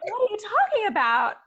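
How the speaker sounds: high-pitched incredulous mom; mock-baffled, high question; retold bafflement. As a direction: act out playful disbelief, voice way up. Retold baffled reply to daughter.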